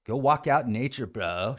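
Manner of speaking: ordinary talk, nothing marked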